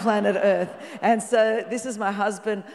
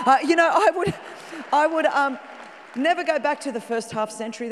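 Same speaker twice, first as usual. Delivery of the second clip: excited; 7 semitones higher, 5 dB louder than usual. Real, not acted. Excited about turning fifty.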